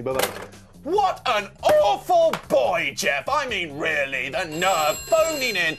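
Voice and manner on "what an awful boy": mock indignation. Mock outrage after call.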